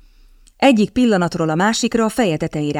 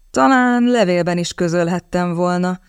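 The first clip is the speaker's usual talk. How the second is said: ordinary delivery, nothing marked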